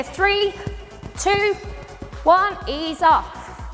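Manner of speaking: high countdown, slowing on ease off; instructor countdown. Shown as a demonstration. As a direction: call the count to the class. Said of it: "And I say".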